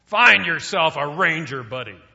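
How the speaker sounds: loud growled order; growling drill sergeant. Retold or imitated. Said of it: "he growled".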